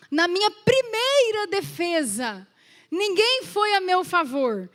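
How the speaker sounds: slow, loud, high proclaiming; preacher declaiming the verse; scripture reading. Acted. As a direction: read Paul's lament with force. Reading Paul's words.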